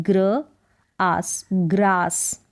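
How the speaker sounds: "ग्र", pause, "आस", then "ग्रास" drawn out slowly; sounding out. Shown as a demonstration. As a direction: split "ग्रास" into gra and aas, then blend. Teacher splits and blends the word. (ग्र-आस, तोड़कर धीरे उच्चारण)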